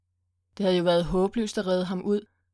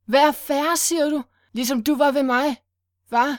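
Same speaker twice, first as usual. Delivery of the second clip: much higher and louder bitter yell; furious shout; 6 semitones higher, 5 dB louder than usual. Acted. Willy yells at Sylvester while kicking him, furious. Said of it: "imens han råbte".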